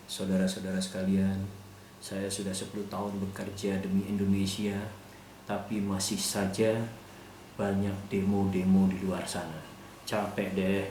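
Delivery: a hint of a weary statesman at the podium; imitating SBY. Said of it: "Pak S B Y itu lebih berwibawa".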